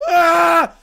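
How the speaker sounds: high loud startled cry; sudden loud yelp of alarm; startled yell. Real, not acted. Reacting to grenade blast.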